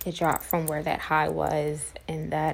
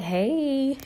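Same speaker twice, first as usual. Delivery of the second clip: imitating excited nurse; 8 semitones higher than usual. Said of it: "she was so excited".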